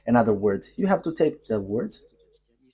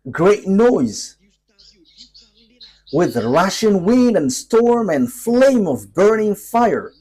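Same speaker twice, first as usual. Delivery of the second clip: raised, drawn-out prophetic proclamation; emphatic preaching; 6 semitones higher, 4 dB louder, slower than usual. Acted. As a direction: proclaim each sign of doom slowly, with rising force. Proclaims the verse's signs of judgment.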